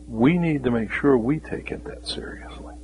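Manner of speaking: hushed, urgent whisper to the congregation; a preacher's grave whisper. Acted. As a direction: lean in and whisper the warning. Sermon drops to whisper.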